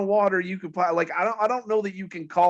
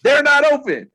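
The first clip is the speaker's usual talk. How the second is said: loud exasperation; 7 semitones higher, 7 dB louder than usual. Real, not acted. Finding second store closed.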